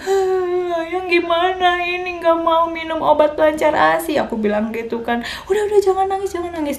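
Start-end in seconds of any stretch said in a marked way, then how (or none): 0.0-4.2 higher, tearful whimpering complaint; crying to husband. Retold or imitated. New mother cries to her husband about her milk, tearful high whimper. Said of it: "nangis sama, ke suami aku". 5.5-6.8 husband comforting. Retold or imitated.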